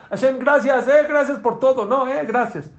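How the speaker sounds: very high, loud cry of thanks to God; showing how to thank, crying out high and loud; loud heartfelt thanks. Shown as a demonstration. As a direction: shout your gratitude to heaven. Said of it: "Así se agradece, señores".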